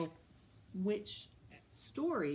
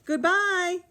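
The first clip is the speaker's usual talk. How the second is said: higher, loud Mama Bear call; middle-sized voice; 11 semitones higher, 8 dB louder than usual. A narrator's reading. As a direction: call out loudly, pitched up. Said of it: "a middle-sized voice".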